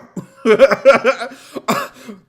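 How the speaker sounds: loud, high-pitched laughing fit; big, high laugh of disbelief; helpless laugh. Real, not acted. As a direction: burst out cackling. Laughing at the missed joke.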